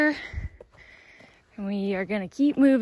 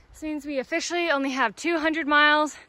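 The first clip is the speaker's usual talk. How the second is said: ordinary talk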